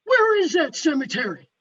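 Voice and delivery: imitated loud man on the phone; interrupting gentleman. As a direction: cut in loudly with a blunt question. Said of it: "he interrupted and said".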